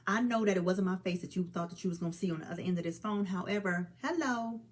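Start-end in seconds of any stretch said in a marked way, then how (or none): none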